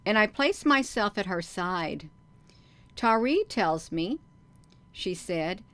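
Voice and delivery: lively, emphatic read-aloud with swinging pitch; story narration. A narrator's reading. Reads the book aloud.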